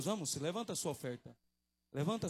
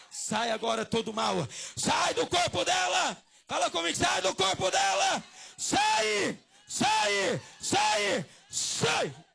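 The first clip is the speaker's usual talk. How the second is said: exorcism shout; 11 semitones higher, 11 dB louder, slower than usual. Real, not acted. Preacher ordering evil out of her body, shouting. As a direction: build to high, screamed commands.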